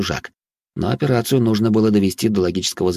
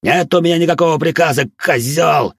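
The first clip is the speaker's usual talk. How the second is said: furious pained shout; yelling in pain; 8 semitones higher, 5 dB louder than usual. A narrator's reading. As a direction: scream it through pain. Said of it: "заорав от боли, выкрикнул Харви".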